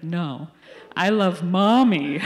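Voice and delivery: a laughing mock toddler insisting; three-year-old's retort. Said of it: "And he's like".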